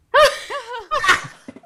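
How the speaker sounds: high, loud laughing fit after the punchline; loud burst of laughter. Real, not acted. Storyteller laughing loudly at his own punchline. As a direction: cracks up hard at his own joke.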